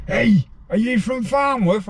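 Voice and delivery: high, slow call across the landing; shouted call. Retold or imitated. Lad calling across the prison at a new inmate, high shout. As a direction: yell it out from across the wing. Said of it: "this lad shouts".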